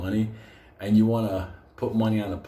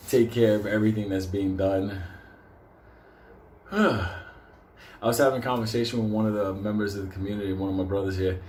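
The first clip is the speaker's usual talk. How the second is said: usual speaking voice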